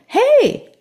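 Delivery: high, ear-splitting lilting "Hey!"; joyous shout. A narrator's reading. Old man interrupting a fight on a train, joyous shout. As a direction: shout it as if delightedly stumbling on something. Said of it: "strangely joyous, lilting quality of it".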